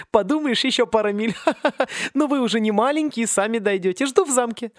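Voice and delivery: high, bright-hearted bossy teacher herding freshmen; a gleeful, teasing headmistress with a laugh; jolly teacher voice. Acted. Teacher cheerfully bossing the seasick freshmen, high and teasing. Teacher teases students again.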